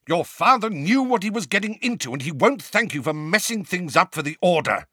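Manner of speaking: high, loud furious retort; angry. A narrator's reading. Sirius angrily scolding the twins, high and loud. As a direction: snap back hard and loud. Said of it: "equally angry".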